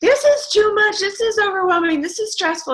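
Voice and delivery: hammed-up imitation of a stressed-out team member; mock overwhelmed teammate. Mocking her team members who feel overwhelmed, high hammed-up whine. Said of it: "they're like".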